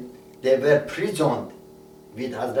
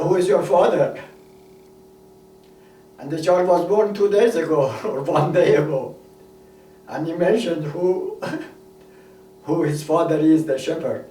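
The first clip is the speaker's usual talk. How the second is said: emotional; 4 semitones higher than usual. Real, not acted. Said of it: "I get a little emotional".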